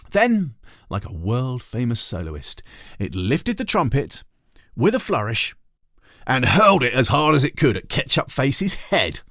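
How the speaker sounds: ordinary delivery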